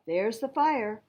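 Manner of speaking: ordinary delivery, nothing marked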